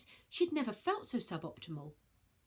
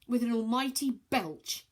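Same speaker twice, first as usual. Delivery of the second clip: ordinary delivery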